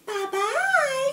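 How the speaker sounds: very high sing-song bye; Twiggy waving goodbye, very high; sweet goodbye. A narrator's reading. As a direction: trill it as she leaves. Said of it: "she waved".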